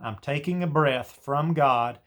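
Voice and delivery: normal talking voice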